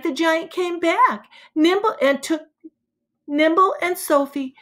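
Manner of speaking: ordinary delivery, nothing marked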